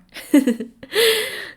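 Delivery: high, bright burst of laughter; high, loud giggle; light laugh. Shown as a demonstration. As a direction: laugh freely, like a casual chat. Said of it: "I also laugh a little bit more".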